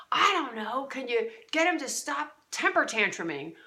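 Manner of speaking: mock exasperated request; exasperated wife. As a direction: snap at him, fed up.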